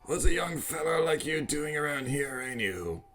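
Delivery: lightly voiced old man professor; a hint of a doddering old scientist.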